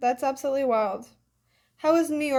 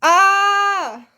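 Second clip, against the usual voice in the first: excited shriek; 8 semitones higher, 11 dB louder than usual. Real, not acted. Reacts loudly to a comment.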